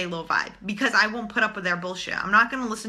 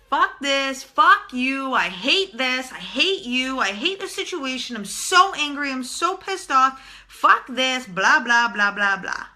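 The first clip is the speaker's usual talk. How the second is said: higher-pitched mock rant, hammered out; mock angry venting; 4 semitones higher, 3 dB louder than usual. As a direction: pretend to let all the anger out, short of a full yell. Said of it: "yelling to the top of your lungs".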